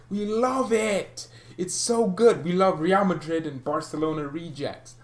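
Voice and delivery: high, slow mock delight; sarcastic gushing. Vlogger mocking his club's love of rejects, sarcastic. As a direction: gush over the rejects with heavy irony.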